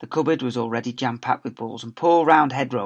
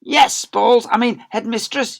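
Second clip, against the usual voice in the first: loud and higher, flustered; teacher calling up to the headmistress; calling back; 11 semitones higher, 10 dB louder than usual. Acted. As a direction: call up to the window, tripping over the words. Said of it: "he called back from the playground".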